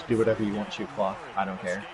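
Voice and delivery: flat, dead-quiet monotone. Retold or imitated. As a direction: drain all feeling out of it. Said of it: "in a very monotone voice".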